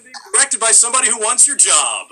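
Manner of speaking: loud, high mock enthusiasm; sarcastic. Actor directed by someone who wants his job, loud sarcasm. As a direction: blurt it out with biting sarcasm.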